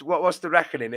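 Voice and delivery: ordinary delivery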